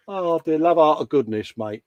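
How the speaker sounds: normal talking voice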